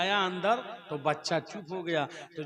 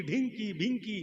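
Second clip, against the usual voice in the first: usual speaking voice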